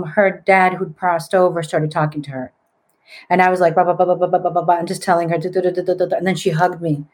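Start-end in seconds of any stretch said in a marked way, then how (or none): 3.7-4.7 nonsense syllables rattled off evenly; imitating nonstop relaying; rapid babble. Mimics relaying a spirit message. 5.4-6.2 quick run of placeholder syllables; imitating nonstop relaying; rapid babble. Mimics telling her the message.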